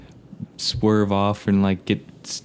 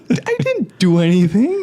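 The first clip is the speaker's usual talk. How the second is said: high, louder mock protest through laughter; laughing protest; 12 semitones higher, 5 dB louder than usual. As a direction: protest your innocence, pitched high, half laughing. Protesting to the attendant in retold incident.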